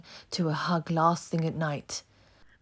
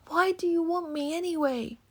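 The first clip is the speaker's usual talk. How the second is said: put-on desperate boy; 12 semitones higher than usual. A narrator's reading. Oliver protests.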